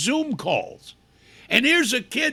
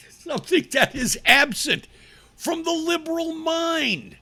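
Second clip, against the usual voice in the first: normal talking voice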